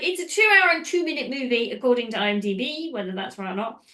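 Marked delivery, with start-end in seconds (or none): none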